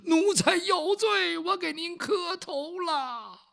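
高声拖长的哭喊，慢慢说; 像老奴哭着磕头谢罪那样拉长每个字; 哭腔请罪. Acted. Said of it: "陈琳在后边这一哭". (演出又高又慢的哭诉)

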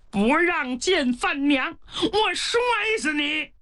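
simple giant's voice rising to a high yell; threatening shout. Acted. Pang Hu threatens to throw Kunhou. (傻大个儿的威胁怒吼)

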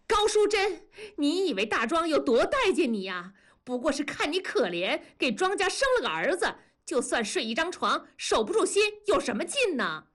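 high, sharp shouted comeback; young woman yelling back at the wife, high and heated; angry retort. A narrator's reading. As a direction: strike back where it hurts. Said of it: "梅梅推开文丽喊".

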